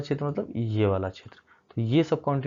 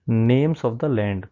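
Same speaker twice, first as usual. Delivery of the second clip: slow heading read; 6 dB louder, much slower than usual. Acted. Teacher reads chapter heading.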